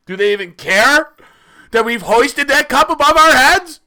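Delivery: loud, high ranting about the team; impassioned rant. Acted.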